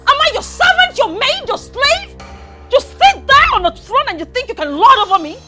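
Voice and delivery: high, loud, indignant shouting; furious tirade. Acted. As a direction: hurl the questions at her in outrage. Angry quarrel with mother-in-law.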